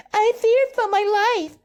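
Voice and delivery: high, mock-panicked woman's voice, way above his own; mocking scared woman. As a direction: squeak it out like a hammed-up frightened lady. Mocking her panicked claim.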